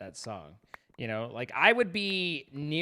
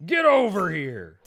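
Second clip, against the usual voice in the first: slow, loud, booming yank call; fighting game battle cry; 5 semitones higher, 11 dB louder, slower than usual. Acted. Gamer yanking an Enderman toward him with a booming shout. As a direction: bellow it like a ninja pulling in prey.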